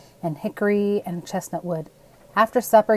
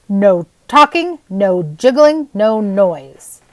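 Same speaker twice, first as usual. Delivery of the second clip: slow, loud stern commands; stern order; 7 dB louder, much slower than usual. Acted. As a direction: lay down the rules firmly. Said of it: "he ordered Thomas".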